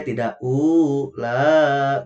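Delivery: ula drawn out slowly; wrong long reading. Shown as a demonstration. Teacher contrasting the wrong reading, slow chant. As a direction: stretch ula as the mistake. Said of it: "tidak seperti itu".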